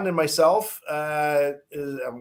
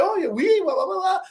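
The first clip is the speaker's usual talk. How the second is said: put-on higher kid voice, eager; imitating excited kid; 14 semitones higher than usual. Seven-year-old boy answering Marty Brodeur in French, higher eager voice.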